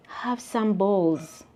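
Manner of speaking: ordinary delivery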